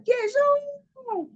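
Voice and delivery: very high, stretched-out call; crying out. Acted. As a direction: call the name high, as if to someone distant. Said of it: "Y él empezó a gritar".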